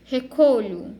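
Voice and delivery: "recolho" with a strong CO and weak final lho; tônica no co. Shown as a demonstration. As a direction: lean on the middle syllable of "recolho". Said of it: "como é no caso de recolho". ("recolho" com força no co e lho fraquinho)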